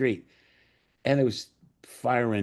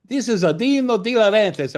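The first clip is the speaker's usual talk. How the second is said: put-on loud, high Italian accent; Dino De Laurentiis; 11 semitones higher, 6 dB louder than usual. Producer names himself to a disbelieving writer, high Italian accent. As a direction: announce yourself with a sing-song Italian accent. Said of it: "when you do the accent".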